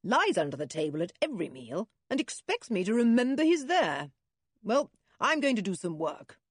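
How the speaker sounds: fast, irritated grumbling rant; impatient father. A narrator's reading. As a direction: rattle off the complaint, fed up. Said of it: "said the impatient man".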